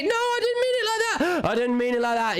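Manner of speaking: high, hammed-up panicky backpedal; mock pleading husband. Acted husband backing down.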